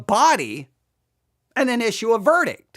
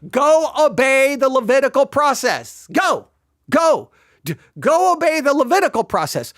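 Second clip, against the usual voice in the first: raised, insistent order; commanding; 5 semitones higher, 4 dB louder than usual. Retold or imitated. Preacher voices Jesus simply commanding the lepers, forceful command. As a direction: order them off firmly, higher-pitched, hammering each go. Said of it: "He simply commands them".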